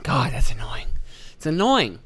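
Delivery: muttered whisper, fed up; whispered annoyance at the boss; annoyed whisper. Real, not acted.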